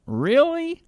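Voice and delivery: high, drawn-out sarcastic really; mock disbelief. Real, not acted. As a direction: scoff at the claim with a high mocking "Really?". Mocking disbelief at climate claim.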